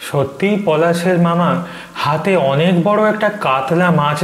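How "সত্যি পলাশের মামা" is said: ordinary delivery, nothing marked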